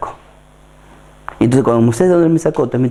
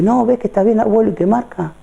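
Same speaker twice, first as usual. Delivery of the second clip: shopkeeper showing; 11 semitones higher than usual. Acted. Shopkeeper voiced in story.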